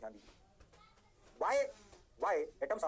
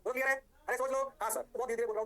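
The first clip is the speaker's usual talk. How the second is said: louder, higher call to the class; hyped call-out; 7 semitones higher, 4 dB louder, faster than usual. Real, not acted. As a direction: rouse them for an answer. Teacher calls out to the class.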